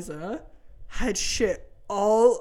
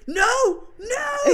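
high, drawn-out imitated cries; freaking out; 13 semitones higher than usual. Mother imitating dad downstairs with dirty diaper, freaking out. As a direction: mimic a panicked wail, pitched way up. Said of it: "he's freaking out".

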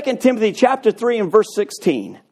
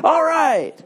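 mock self-congratulation; 4 semitones lower than usual. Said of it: "You can't become".

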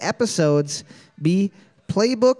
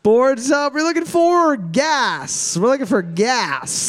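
announcer call-out; 6 semitones higher, slower than usual. Real, not acted. Host revealing the answer to trivia teams, announcer call-out. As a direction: belt it out, drawing out the answer.

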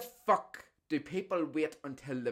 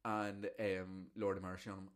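normal talking voice

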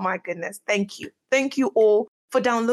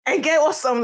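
ordinary talk, nothing marked

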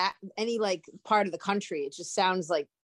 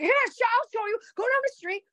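high, strained mock yell; retold scream; 13 semitones higher, 3 dB louder than usual. Retelling a Rhode Islander giving a lost traveler directions, mock scream. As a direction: imitate a brash local yelling directions. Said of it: "the guy screamed in my face".

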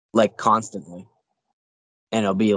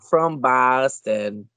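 put-on slow, drawn-out Boston accent; 2 semitones higher, much slower than usual. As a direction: stretch it like the Boston ad. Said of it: "Sam Adams commercial where he's like".

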